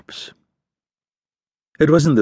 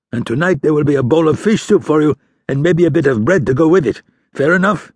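put-on higher, louder bossy old fisherman; gruff old fisherman; 6 semitones higher, 3 dB louder than usual. Old fisher going through the dead man's clothes and ordering his son. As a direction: order the boy about like a hard old father.

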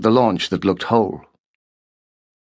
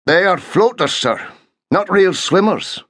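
gruff sergeant; 8 semitones higher than usual. A narrator's reading. Sergeant objecting to order.

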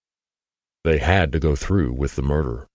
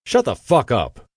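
higher, louder furious yell; screaming; 7 semitones higher, 4 dB louder than usual. A narrator's reading. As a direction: snap her sister into silence. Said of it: "Margaret screamed".

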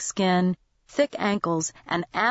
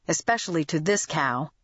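usual speaking voice

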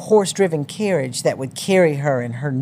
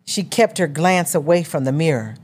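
plain reading voice

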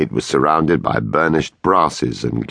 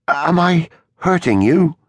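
anxious; 12 semitones higher than usual. A narrator's reading. Anxious questioning of wife.

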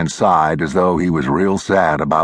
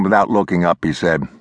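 usual speaking voice

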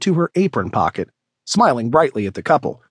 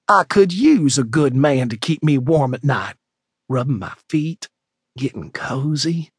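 Bea's teasing voice. A narrator's reading. To Joe in the kitchen, a mock-sultry tease trailing off. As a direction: dangle the image just to make him squirm. Bea teasing about men.

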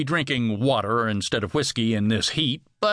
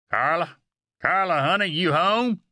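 raised, higher calling voice; 7 semitones higher than usual. A narrator's reading. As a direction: call through the house for your wife. Husband calls into the house.

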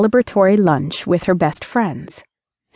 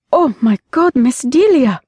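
high, loud horrified exclamation; shocked gasp; 6 semitones higher, 3 dB louder than usual. Acted. Housekeeper bursting in on the bloody scene, horrified. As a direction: recoil in horror at the scene.

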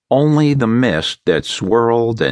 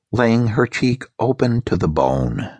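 ordinary delivery

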